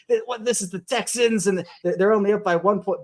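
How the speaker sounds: mildly panicked disbelief, imitated; mock freak-out. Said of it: "Everyone's freaking out. They're like".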